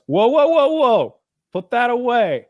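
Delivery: high, loud, slow "whoa" warning; mock alarmed cheater. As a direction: wave him off in a put-on high voice. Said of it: "And the guy goes".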